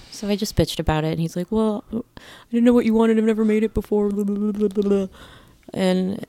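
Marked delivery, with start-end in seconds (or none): 1.5-5.1 a lightly higher put-on voice for the defensive cook; imitating the cook. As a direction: mimic the cook making excuses, a bit higher and whiny. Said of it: "That was an amazing interpretation of his voice".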